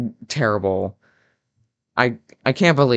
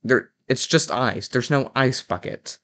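normal talking voice

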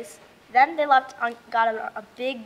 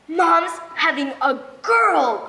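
loud, drawn-out happy announcement; higher, slow, excited reveal; excited exclamation; 5 semitones higher, 4 dB louder, slower than usual. A narrator's reading. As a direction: burst out with big news, stretching the words. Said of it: "I exclaimed".

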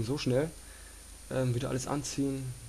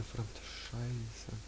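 cursing under breath; 2 semitones lower, 9 dB quieter, much slower than usual. Real, not acted. Frustrated, quiet slow curse.

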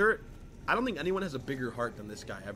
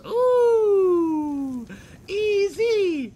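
hype shout; 11 dB louder, much slower than usual. Real, not acted. Hype calls over a beat.

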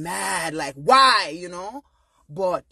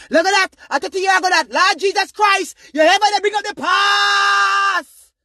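shouted, high, slow ranting parent; mock angry parent; 11 semitones higher, 10 dB louder than usual. Parent shouting down a hurt child, mock rage. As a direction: yell it like a parent refusing to own the past.

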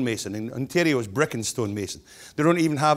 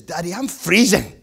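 slow, higher, laughing cub voice; shivering cub; 5 semitones higher, 6 dB louder, slower than usual. Acted. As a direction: deliver the punchline slowly. Punchline of joke.

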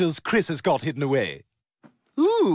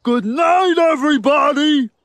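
loud, high farewell shout; big sign-off; 9 semitones higher, 7 dB louder than usual. Acted. Performer to everybody, shouting good night. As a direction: belt it out to the whole crowd. Show-ending call to the audience.

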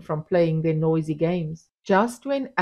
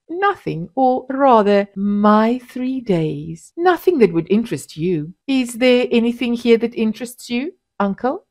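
malicious; 5 semitones higher, 5 dB louder than usual. A narrator's reading. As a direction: needle him with a smile. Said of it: "a malicious smile on her lips".

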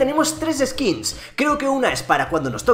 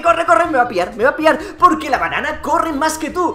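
higher, louder panicked urging; being chased; 5 semitones higher, 3 dB louder than usual. Real, not acted. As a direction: yell to run while chased. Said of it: "que me persigue".

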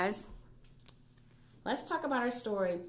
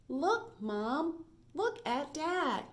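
lively read-aloud, quoted voices pitched higher; reading to children; 4 semitones higher, much slower than usual. A narrator's reading. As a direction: read the story to little kids, bright and sing-song. Reads picture book aloud.

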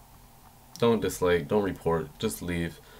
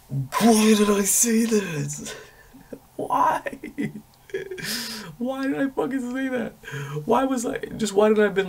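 much higher, louder, slow self-mocking wail; exasperated at himself; 4 dB louder, slower than usual. Real, not acted. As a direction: groan at your own stupidity in a squeal. Mocking his own dumb answer.

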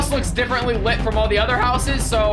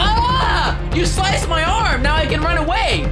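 mock heroine cry; 6 semitones higher, 3 dB louder, slower than usual. Voicing the heroine.

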